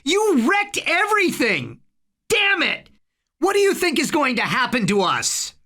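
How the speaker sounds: high-pitched, furious scolding; angry, panicked shriek held in check; screamed. A narrator's reading. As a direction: lash out in a high voice, frantic with blame. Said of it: "Harry screamed".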